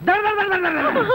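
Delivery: high, rattling gunfire noise; imitated machine-gun fire. Describing the fearsome father shooting crowds, imitated gunfire.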